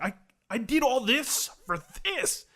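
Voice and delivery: high, slow incredulous protest; drawn-out, high-voiced mock indignation; imitated frustration. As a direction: act out his exasperated disbelief. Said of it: "he was frustrated".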